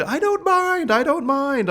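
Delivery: high, light, easygoing voice; mimicking Joanne's high chirpy reassurance; Joanne's sweet reply. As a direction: toss it off sweetly, eager to help.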